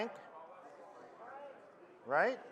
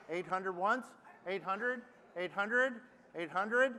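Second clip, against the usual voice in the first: auctioneer; 5 dB quieter than usual. Acted. Auctioneer bid calling.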